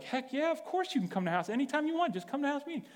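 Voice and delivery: high-pitched, enthusiastic yes; eager welcome. Retold or imitated. Telling Pete he can come to house meeting, high and eager. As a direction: welcome him back with open, excited eagerness.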